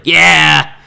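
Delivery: loud excited yeah; excited shout. Real, not acted. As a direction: cheer it out. Excited cheer about vengeful spirit.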